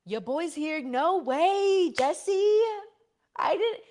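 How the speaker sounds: high, loud excited greeting; delighted surprise. Real, not acted. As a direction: shout it out, thrilled to see a friend turn up. Spots a friend in live chat.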